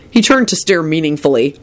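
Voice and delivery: ordinary delivery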